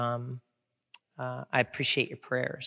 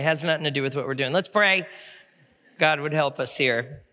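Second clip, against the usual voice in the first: normal talking voice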